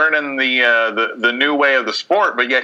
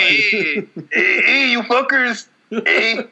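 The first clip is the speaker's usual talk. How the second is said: put-on higher jokey Mexican-accent calling out; 6 semitones higher, much slower than usual. Showing how his accents all slide into Mexican. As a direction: hail the guys in a hammed-up Mexican accent. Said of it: "all mine devolve into Mexican".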